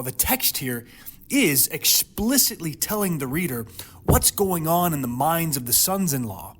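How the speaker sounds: ordinary talk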